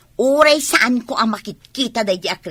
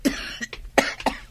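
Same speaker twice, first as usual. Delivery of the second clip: weak cough; 5 dB quieter than usual. Acted. Sick character coughing.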